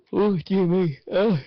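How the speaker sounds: sighing through a yawn; yawning out the words. Real, not acted. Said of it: "Come on, shape up, soldier".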